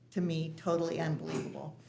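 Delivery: ordinary talk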